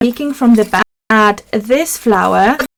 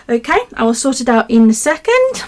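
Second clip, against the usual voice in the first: usual speaking voice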